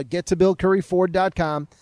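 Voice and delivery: ordinary talk, nothing marked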